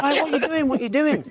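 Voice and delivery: high, laughing what are you doing; laughing protest. Retold or imitated. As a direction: protest through laughter, half joking. Confused protest retold, laughing.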